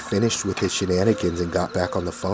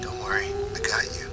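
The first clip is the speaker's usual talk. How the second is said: soft, quick whispered reassurance; secretive phone whisper; hushed quick whisper; 11 dB quieter, faster than usual. Acted. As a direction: whisper fast so nobody overhears. Hiding call from parents.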